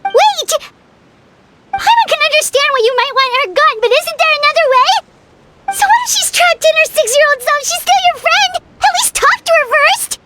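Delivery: high, urgent plea to stop a killing; desperate high-pitched protest to a stern father; urgent pleading. Acted. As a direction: cry out to stop her, begging. Paimon begs her to spare Clairvy.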